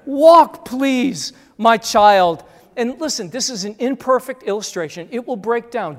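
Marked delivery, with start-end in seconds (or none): 0.1-2.4 loud, higher urging; pleading parent. Retold or imitated. Parent urging tired child to walk, loud pleading.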